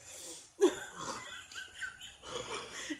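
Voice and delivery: breathless high-pitched giggle; high squealing laugh. Real, not acted. Laughing at bizarre FaceTime story.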